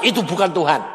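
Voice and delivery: ordinary delivery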